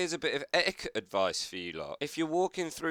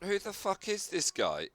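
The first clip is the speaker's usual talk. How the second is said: usual speaking voice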